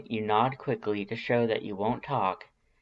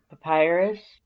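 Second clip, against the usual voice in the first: calling out; 7 semitones higher, 6 dB louder than usual. A narrator's reading. Calling to Papyrus.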